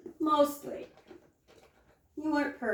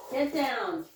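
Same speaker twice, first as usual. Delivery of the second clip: usual speaking voice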